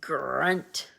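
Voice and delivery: low whispered pig grunt. Acted. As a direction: grunt like a boar. Pig noise in story.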